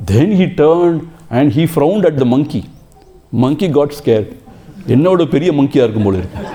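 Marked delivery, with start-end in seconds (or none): 4.9-6.4 rattled off fast through laughter; laughing punchline. Retold or imitated. Storyteller voicing the scared monkey, laughing fast. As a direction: giggle the monkey's thought out quickly.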